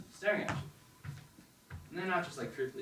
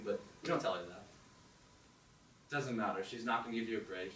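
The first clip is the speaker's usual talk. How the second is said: ordinary talk, nothing marked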